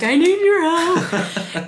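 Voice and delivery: high, slow, half-laughing cry for help; panicked plea. Retold or imitated. Calling her partner in a panic with a bloody finger, high laughing plea. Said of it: "and I'm like".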